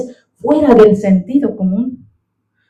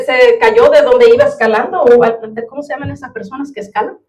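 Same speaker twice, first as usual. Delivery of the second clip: usual speaking voice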